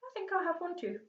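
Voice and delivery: put-on a courteous old tramp offering a seat; Mister Stink. A narrator's reading.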